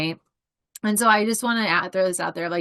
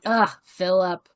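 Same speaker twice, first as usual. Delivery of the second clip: sassy exasperation. Retold or imitated. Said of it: "if I'm gonna sass you".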